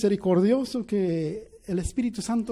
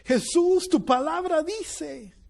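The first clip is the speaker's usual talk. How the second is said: retold lament; 8 semitones higher, slower than usual. Empieza a llorar y empieza a gemir.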